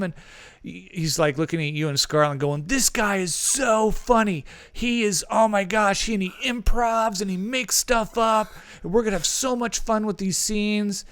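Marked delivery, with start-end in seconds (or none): 2.7-11.0 high-pitched excited raving; imitation of a gushing director; Michael Bay. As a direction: rave about your new favorite, voice pitched up. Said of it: "he was just bouncing off the walls".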